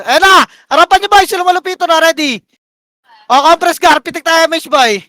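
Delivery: high, loud engage call; urgent shot-calling. Real, not acted. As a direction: shout the go signal to the team.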